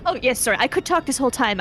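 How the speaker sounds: ordinary talk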